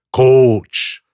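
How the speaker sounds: mock Cajun call; Cajun accent. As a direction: imitate a southern Louisiana coach. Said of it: "my assistant coach is also from Southern Louisiana".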